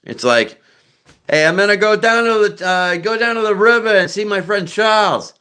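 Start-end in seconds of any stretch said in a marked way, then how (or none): 1.3-5.3 high-pitched Boston accent, R's dropped; hammed-up Boston accent. Shown as a demonstration. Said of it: "That's Boston accent".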